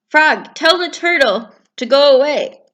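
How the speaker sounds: bossy Toad. Acted. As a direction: demand it.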